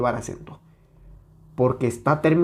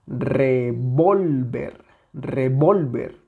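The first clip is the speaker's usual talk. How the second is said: revólver very slowly, VOL stretched and punched; stress on VOL; much slower than usual. Shown as a demonstration. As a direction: exaggerate the middle syllable of revólver. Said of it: "la más intensa".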